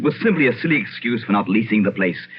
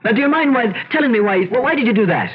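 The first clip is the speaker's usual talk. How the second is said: startled scolding; 10 semitones higher than usual. Acted. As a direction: blurt it out, rattled and indignant. Startled master scolds the butler.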